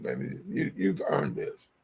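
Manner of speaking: a soft whisper quoting his wife; hushed imitation of a proud wife. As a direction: breathe it like the wife leaning in close. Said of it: "she excited and said".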